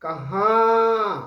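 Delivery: कहां with the final vowel drawn out long and loud through the nose; कहां said slowly and loudly, letting the nasal vowel ring through mouth and nose; nasal vowel demo. Shown as a demonstration. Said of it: "आप गौर कीजिए उस पर".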